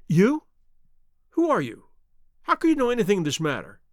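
startled, then hurrying into the question; alarmed questioning. A narrator's reading. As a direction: challenge him nervously. Frightened little man questions Holmes.

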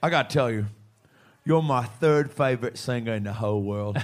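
a clumsy, half-British try at Australian; bad Australian accent. Retelling the fan's words in a bad Aussie accent. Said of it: "is that British?".

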